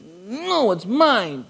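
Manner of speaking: put-on high pouting child voice; a kid clutching his toy, whining no; imitating a kid refusing to share; whiny kid. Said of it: "You ever seen a kid with a toy?".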